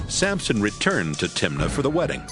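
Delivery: normal talking voice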